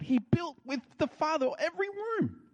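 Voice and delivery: high, soaring preacher's cry; impassioned climax. Real, not acted. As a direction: lift the voice high to land the point.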